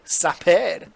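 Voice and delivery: Sapper in a mock French accent; a light, playful French accent on Sapper. Shown as a demonstration. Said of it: "that's a French accent".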